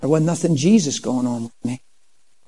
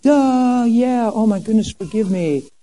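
acting nice; 6 semitones higher, slower than usual. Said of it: "So I acted nice".

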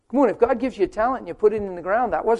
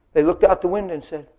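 ordinary talk

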